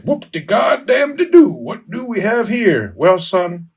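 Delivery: high, hammy folksy cop voice; folksy old sitcom dad. Retold or imitated. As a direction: play a corny small-town lawman. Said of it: "and he's like".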